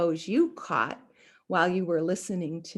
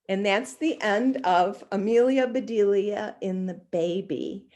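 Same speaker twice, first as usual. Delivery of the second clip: ordinary delivery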